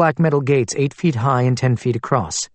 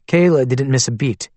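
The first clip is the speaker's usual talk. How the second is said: usual speaking voice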